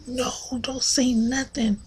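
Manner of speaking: whispered don't say nothing; hushed plea. Retold or imitated. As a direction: whisper it urgently so grandma won't hear. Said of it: "I'm like".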